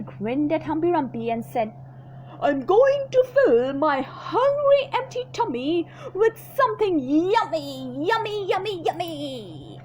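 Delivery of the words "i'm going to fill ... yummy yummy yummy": high, slow singsong yummy; greedy crocodile. Acted. Crocodile teasing the hippo, greedy singsong. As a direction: drool over every yummy. Crocodile savouring his lunch.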